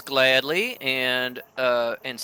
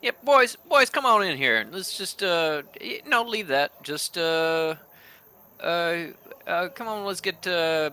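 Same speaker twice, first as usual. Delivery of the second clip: put-on calling the boys; 6 semitones higher than usual. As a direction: round up the boys, call them in. Nine calls her team inside.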